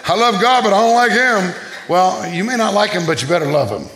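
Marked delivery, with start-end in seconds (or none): 0.1-1.5 higher-pitched mimicry of a grudging believer; mock self-justifying churchgoer. Preacher mimicking people who love God but not others, mock self-justifying. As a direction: lightly send up someone making excuses.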